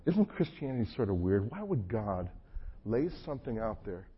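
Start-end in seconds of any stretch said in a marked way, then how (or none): none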